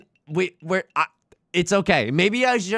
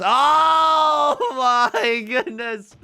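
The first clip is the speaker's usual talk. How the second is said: shocked cry; 10 semitones higher, 6 dB louder, much slower than usual. Real, not acted. Reacting to unbelievable luck.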